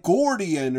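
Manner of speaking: "Gordian" as a funny way of saying guardian; mimicked mispronunciation, "Gordian" for guardian; odd pronunciation. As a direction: say "Gordian" with a GOR first syllable, imitating the character. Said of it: "however he says guardian".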